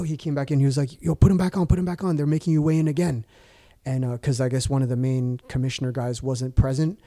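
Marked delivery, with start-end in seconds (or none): 1.0-3.2 higher-pitched hurried warning, imitated; urgent coach. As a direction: urge him on as a mock panicked coach. Retold coach urging him to hurry.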